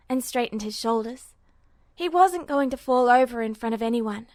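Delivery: plain reading voice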